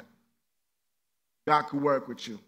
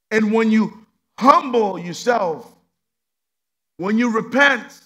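slow preaching; 4 semitones higher, 9 dB louder, slower than usual. Acted.

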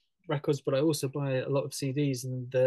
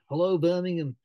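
a quick mock Birmingham accent, higher and louder; Brummie accent; 5 semitones higher, 7 dB louder than usual. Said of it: "he said it in a Brummie accent".

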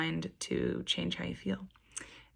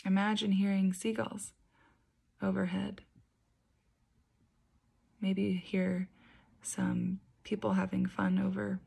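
guided meditation. Acted. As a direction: gently guide them into calm, unhurried and even. Leads a guided beach meditation.

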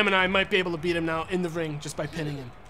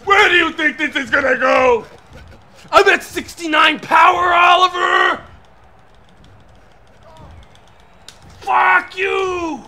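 furious high yells at the rival, full force; angry shouting; 8 semitones higher, 9 dB louder, slower than usual. Real, not acted. Gamer yelling at his rival Oliver, furious shout. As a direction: scream the insults, louder and higher.

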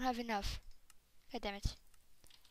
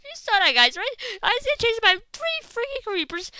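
usual speaking voice

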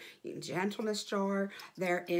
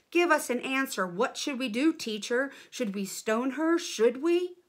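loud, insistent demand, rising higher at the end; demanding crowd; 4 semitones higher, 5 dB louder than usual. Acted. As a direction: press him for an answer, louder and louder. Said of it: "The men kept demanding over and over".

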